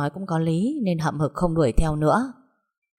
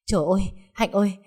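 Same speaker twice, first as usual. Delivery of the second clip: frightened; 5 semitones higher than usual. Acted. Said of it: "sợ hãi".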